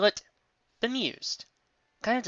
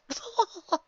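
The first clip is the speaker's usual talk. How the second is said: stifled giggle. Acted. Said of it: "hiding a churl".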